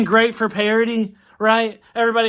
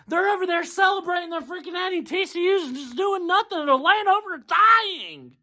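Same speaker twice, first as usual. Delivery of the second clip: frustrated shout; 7 semitones higher than usual. Real, not acted. Fan yelling about rival fans celebrating.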